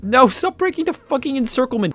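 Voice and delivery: high, loud exasperated shout; frustrated yell. Real, not acted. Yelling at his troops breaking the encirclement, exasperated.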